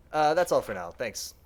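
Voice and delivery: usual speaking voice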